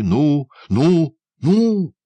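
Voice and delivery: high, loud, climbing cries; frantic urging. A narrator's reading. Prisoner pushing the boy to help him escape, frantic urging.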